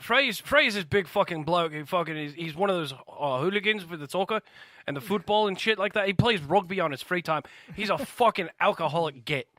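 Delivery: put-on British accent. Describes Freddy in put-on British.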